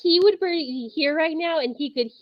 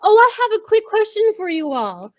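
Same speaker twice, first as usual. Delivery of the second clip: cheerful call to the room; 7 semitones higher, 7 dB louder than usual. Acted. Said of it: "I'm going to say".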